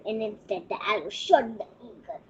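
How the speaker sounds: ordinary delivery, nothing marked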